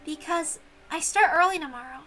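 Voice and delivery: put-on young servant girl bidding farewell; grateful young maid. Shannon's voice.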